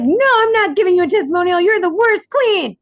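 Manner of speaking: high, loud pretend outrage; mock indignant refusal. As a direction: play a hammed-up scornful no. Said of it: "And then say no".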